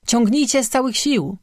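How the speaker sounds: understated shout, pitched well up; shouted order. A narrator's reading. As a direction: urge the rescuers on with a contained, high cry. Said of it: "krzyknął ksiądz". (powściągliwy okrzyk, wysokim tonem)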